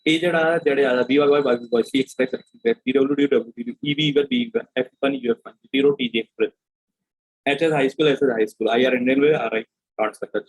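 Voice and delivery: teacher showing revision speed; quick recall drill. Shown as a demonstration. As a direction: rattle off the codes at speed. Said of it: "मैं किस speed में बोलता हूँ".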